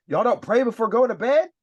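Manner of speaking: high, loud disbelieving country voice; imitating a stunned roommate, pitched high; shocked recruit. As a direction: act out the recruit who can't believe it. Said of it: "one guy was like".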